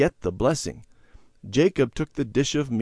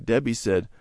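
ordinary delivery

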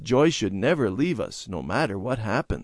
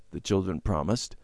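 ordinary delivery, nothing marked

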